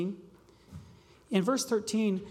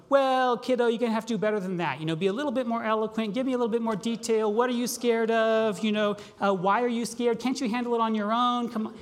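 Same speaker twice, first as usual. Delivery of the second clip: put-on fast, louder, brushing the child off; impatient father; 3 semitones higher, 4 dB louder, faster than usual. Mock father scolding scared child, fast and loud. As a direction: rattle off a dismissive lecture.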